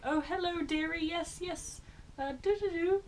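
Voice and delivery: high, hammed-up posh lady; mock posh dearie voice; posh camera voice. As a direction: put on a silly posh voice. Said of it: "my voice would change to go really posh".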